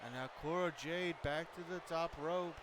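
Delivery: excited wrestling commentary; live play-by-play. Acted. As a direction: call the match like a ringside announcer.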